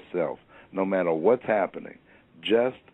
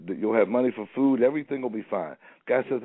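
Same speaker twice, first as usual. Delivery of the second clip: ordinary talk, nothing marked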